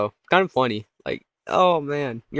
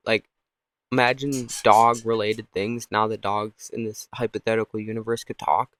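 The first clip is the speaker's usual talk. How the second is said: ordinary talk, nothing marked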